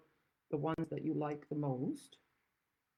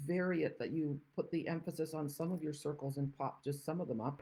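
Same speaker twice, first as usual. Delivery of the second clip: usual speaking voice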